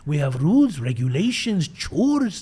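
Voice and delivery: Yoda impression with a Persian accent, pitch swooping. Sober-living host welcoming a newcomer, Persian Yoda accent. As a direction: imitate a little Persian Yoda. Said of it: "he sounded like Yoda with a Persian accent".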